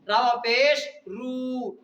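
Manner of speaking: रावा पेश रू read slowly, the रू held long; stretched reading. Shown as a demonstration. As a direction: draw out रू as the teacher shows it. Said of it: "इसको खींच क्यों रहा है".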